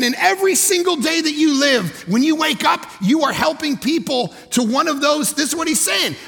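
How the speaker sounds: high and loud, driving home the point; full-force preaching. Real, not acted. Pastor urging the congregation, full-force preaching. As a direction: preach it hard to the room.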